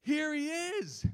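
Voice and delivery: high, drawn-out joyful shout; yelled out. Acted. Preacher reads John seeing Jesus coming toward him, yelling out. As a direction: yell it slowly across a crowd. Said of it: "and yelled out".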